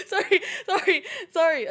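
loud, high, giggly sorries; laughing apology. Real, not acted. Streamer recovering from a laughing fit, giggly apology. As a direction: apologise through real laughter.

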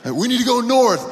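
high, loud urgent call; calling survivor. Retold or imitated. Survivor yelling at group, loud urgent call. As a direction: shout to the group to move.

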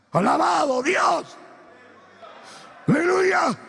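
exclamación alargada, muy aguda y fuerte; alabanza a gritos. Real, not acted. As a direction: lanza la alabanza a toda voz. Preacher shouts praise to congregation. (grito jubiloso de predicador)

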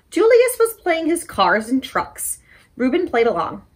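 put-on lively picture-book read-aloud for kids; story time reading. A narrator's reading. Librarian reading a story to children, animated. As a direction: read it bright and animated to little listeners.

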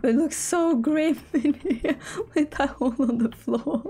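slow, amused, giggling as she says grim; tickled by her own new word, slow and giggly; laughing through it. Real, not acted. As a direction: let the laugh spill into the words. Jokingly reusing her new word.

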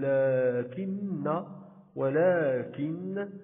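لكن with a long, held "laa"; alif lengthened. Shown as a demonstration. Teacher showing the unwritten madd, لكن and ولكن with laa drawn out. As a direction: stretch ولكن slowly as wa-laaa-kin. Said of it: "نقوم بمد الألف".

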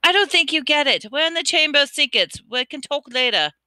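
high, quick impatient push; losing patience. Acted. Harry losing patience with Riddle in the Chamber, impatient. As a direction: cut him off, fed up. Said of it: "said Harry, losing patience".

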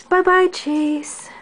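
slow, high singsong farewell; drawn-out sweet goodbye. Real, not acted.

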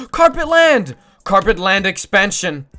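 a high, slow, delighted shout; thrilled cry. Real, not acted. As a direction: announce it like a big victory. Finds carpet, gasps with joy.

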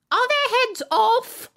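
high, loud, slow shout; shouting queen. A narrator's reading. Queen shouting to the soldiers, high and loud. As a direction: shout the question across. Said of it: "shouted the Queen".

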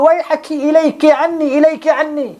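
a man pleading while he cries, half-acted; tearful plea. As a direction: beg him away as if weeping. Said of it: "faja'al Atha yabki".

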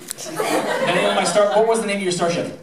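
usual speaking voice

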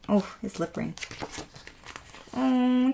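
normal talking voice

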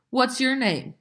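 high-pitched demanding question; calling out. A narrator's reading. Dicey questions a new boy, high call. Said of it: "Dicey called".

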